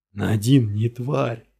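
slow, strained hoarse cry; hoarse shout. Acted. Suspect crying out defending Nadine, hoarse slow shout. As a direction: force it out of a worn voice. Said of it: "закричал Гирт охрипшим голосом".

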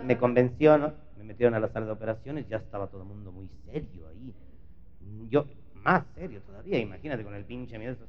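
ordinary talk, nothing marked

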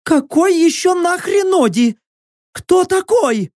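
very high, indignant shriek; screamed challenge. A narrator's reading. Prisoner demanding who Odi is, high angry scream. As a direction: shriek the question back in disbelief.

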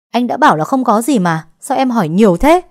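loud, high angry snap; irritated shout. Acted. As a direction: lose his temper and yell. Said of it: "Tuấn bực tức rồi quát lên".

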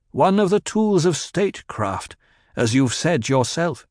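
a touch of bitterness; bitter. A narrator's reading. Paul answering his mother, mildly bitter. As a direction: let a little resentment in. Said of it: "heard the bitterness in his voice".

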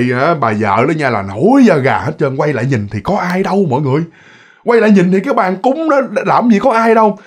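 ordinary delivery

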